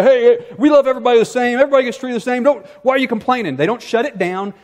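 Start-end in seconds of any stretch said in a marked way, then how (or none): 0.0-3.5 mock defensive leader, higher and louder. Leaders snapping back at complaining widows, mock defensive. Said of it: "They don't get defensive and say".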